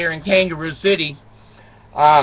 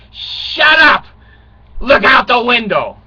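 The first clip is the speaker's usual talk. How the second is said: mock shout; 5 semitones higher, 5 dB louder, slower than usual. Dad snaps at daughter.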